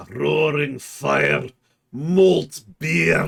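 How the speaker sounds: put-on Dwarven accent. Streamer playing up a tavern mood for chat, slow Dwarven accent. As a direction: savor each word like a Dwarf at the tavern. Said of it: "You gotta say it right".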